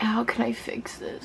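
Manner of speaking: unvoiced worried whisper. Real, not acted. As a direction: whisper it in worry. Repeats in panic, varied voices.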